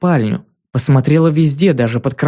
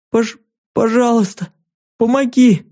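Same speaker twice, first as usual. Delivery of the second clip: put-on high, sobbing child's plea; sobbing little girl; 8 semitones higher than usual. A narrator's reading. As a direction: beg through sobs like a small girl. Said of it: "вырывающихся наружу рыданий".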